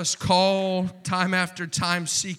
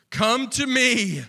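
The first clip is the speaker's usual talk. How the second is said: swelling call; 6 semitones higher, 6 dB louder, slower than usual. Shown as a demonstration. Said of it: "repeated line, second louder".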